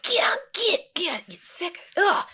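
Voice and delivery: high, strained squeal of revulsion; retold disgust. Mom to kid combing through bear fur, disgusted. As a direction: act out grossed-out panic, ending in ugh. Recoiling from child in rug.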